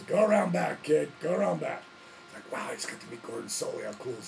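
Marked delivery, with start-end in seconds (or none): 0.0-1.8 louder, firm announcer's command; gruff order. Retold or imitated. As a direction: Gordon Solie sending the kid around back, loud and firm. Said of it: "And he says".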